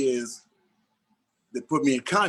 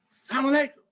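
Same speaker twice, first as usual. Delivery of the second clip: much higher greeting called out; calling again inside the gate with no answer; 11 semitones higher, much faster than usual. Retold or imitated.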